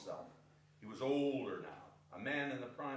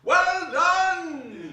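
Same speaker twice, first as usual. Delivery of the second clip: high, loud cheer; jolly master cheering the dance; hearty cry; 18 dB louder than usual. Acted. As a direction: applaud the dancers with a booming shout. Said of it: "cried out".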